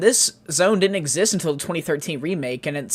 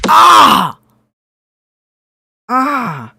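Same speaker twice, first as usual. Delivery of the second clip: pained yell; 8 semitones higher, 10 dB louder than usual. Real, not acted. Pained cries at head rush.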